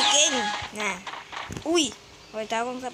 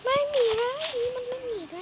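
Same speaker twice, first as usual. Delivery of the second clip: high-pitched complaint; 11 semitones higher, 4 dB quieter than usual. Real, not acted. Searching, voice jumps high.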